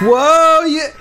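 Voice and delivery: high, loud cheer for a friend; excited cheer. Retold or imitated. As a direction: whoop it up like an excited buddy. Friends cheering his return.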